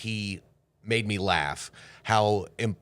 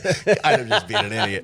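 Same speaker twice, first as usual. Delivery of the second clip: high, giggling self-mockery; laughing; 10 semitones higher, 5 dB louder than usual. Laughing while quoting his own nervous thoughts. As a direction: laugh through the line at yourself.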